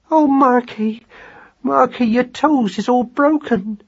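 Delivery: high, slow, anxious wife; pained, high-voiced sympathy; distressed lament. A narrator's reading. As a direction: fret over your husband's injury, high and drawn out. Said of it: "she whispered".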